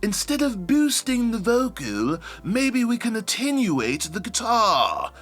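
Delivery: high, slow formal tech-expert voice; technical voice. Shown as a demonstration. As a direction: announce it like a nerdy engineer. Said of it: "my technical voice sounded like that".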